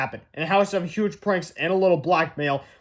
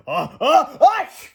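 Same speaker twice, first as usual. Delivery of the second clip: mock sneeze; 8 semitones higher, 7 dB louder, much slower than usual. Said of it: "repeated the fans".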